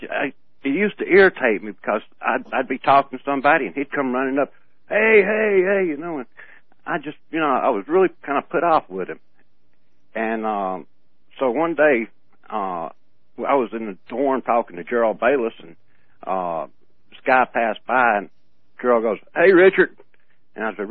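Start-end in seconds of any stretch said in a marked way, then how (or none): 4.9-5.9 higher, louder eager greeting call; hey hey hey, loud and high; retold calling out. Guy running up calling out. 19.3-20.0 much louder, higher hail; loud, high call to a passerby; retold call out. Friend calls to passing guy.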